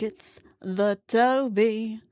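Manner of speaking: slow, playful sing-song introducing the cat Toby; sing-song cat intro. Real, not acted. Presenting the cat to viewers.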